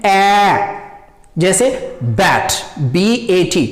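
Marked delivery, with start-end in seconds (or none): 0.1-0.8 long, open "ए" as in English "bat"; open vowel demo. Shown as a demonstration. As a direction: stretch "ए" into a wide "ae" sound. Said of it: "थोड़ा different अंदाज में". (खुला, लंबा "ऐ" जैसा "ए")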